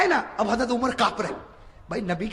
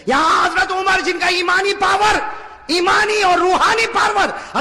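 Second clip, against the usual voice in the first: emphatic preaching; 10 semitones higher, 7 dB louder, slower than usual. Acted. Rising preaching.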